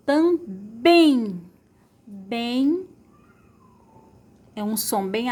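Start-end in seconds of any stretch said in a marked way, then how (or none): 0.1-2.9 "Também" split, loud long BEM, then "Bem" alone; "Também" with strong open BEM, repeated by itself; stressed final syllable. Shown as a demonstration. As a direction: punch and stretch BEM in "Também". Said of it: "forte é o bem".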